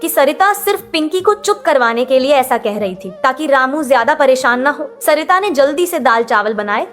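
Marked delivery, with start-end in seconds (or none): none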